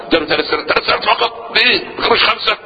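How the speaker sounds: imitating an incredulous busybody; mock gossip's astonishment. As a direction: play a nosy gossiper, pitch climbing on the questions. Acting out a gossip's questions.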